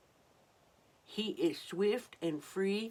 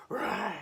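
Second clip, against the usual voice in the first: put-on playful roar for children; hammed-up roar; mock lion roar; 3 semitones higher, 3 dB louder than usual. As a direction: give a big storybook roar. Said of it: "roared the lion".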